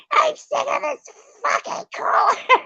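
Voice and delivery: high yelled rant breaking into laughter; fed up with the leaking cooler, shouting through laughs; laughing mock rant. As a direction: yell it while cracking up. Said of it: "So I got all mad".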